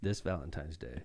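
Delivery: low, very soft seductive murmur; mock-sultry radio ad, hushed and low; sexy voice. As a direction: read it like a hushed come-on. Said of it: "I'm gonna do it in a sexy voice".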